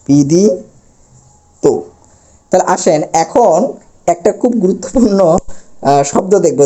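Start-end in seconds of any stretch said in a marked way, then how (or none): 0.1-1.6 বিদিত slowly broken up, "bidi... to"; syllable-by-syllable demo. Shown as a demonstration. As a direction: sound out বিদিত with a pause before the final "to". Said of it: "বলতে হবে বিদিত". (বিদিত ধীরে ভেঙে, শেষে ও-কার)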